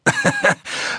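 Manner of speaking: kurzes, hohes, gehässiges Lachen; hohes Schurkenlachen. Acted. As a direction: lach über ihre Hilflosigkeit. Killer lacht nach Drohung. (hohes, spöttisches Lachen)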